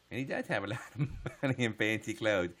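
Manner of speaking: ordinary talk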